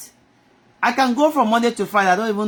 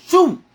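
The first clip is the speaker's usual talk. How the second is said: whoosh sound effect; 8 semitones higher, 6 dB louder than usual. Retold or imitated.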